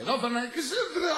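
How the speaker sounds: high, put-on little voice; tiny drunken voice. Drunk chimes in on the order, high little voice. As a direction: run the order into a high squeak. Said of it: "una vocecita".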